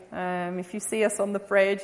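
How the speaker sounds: ordinary delivery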